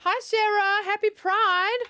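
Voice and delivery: high, loud cheerful hello; bright excited greeting. Real, not acted. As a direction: greet her with a big smile.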